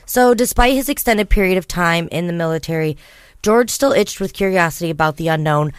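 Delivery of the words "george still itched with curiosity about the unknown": ordinary talk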